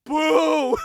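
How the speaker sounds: loud, high mock boo; retold crowd booing the wrestler as he leaves. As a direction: boo like a let-down arena. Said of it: "everyone's like".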